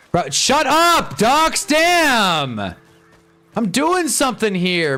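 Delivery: high, loud frustrated shouting; furious yelling. Real, not acted. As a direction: yell at the ducks in fury. Yelling at noisy ducks.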